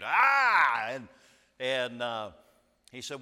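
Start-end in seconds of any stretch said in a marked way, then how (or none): 0.0-0.9 short pained yell of Ah; sharp cry of pain; retold scream. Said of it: "I mean, I screamed".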